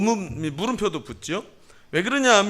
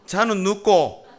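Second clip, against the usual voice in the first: Gyeongsang dialect; 2 semitones higher, 4 dB louder, slower than usual. Shown as a demonstration. Pastor shows "who is that child", Gyeongsang accent. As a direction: ask it slowly with Gyeongsang pitch accent. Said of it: "경상도 말로 네 자로 하면".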